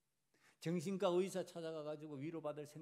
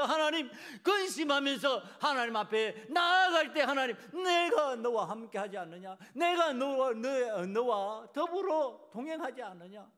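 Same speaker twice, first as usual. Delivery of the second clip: loud, raised preaching voice; impassioned preaching; 9 semitones higher, 13 dB louder than usual. Real, not acted. Preacher urging the congregation to grieve and pray, impassioned. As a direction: drive it home to the congregation with long, stretched key words. Preaches at full force, acting prayer.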